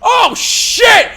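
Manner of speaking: high, loud startled cry; loud scared curse; frightened yelp. Retold or imitated. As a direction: shriek at the zombie baby. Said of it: "I was like".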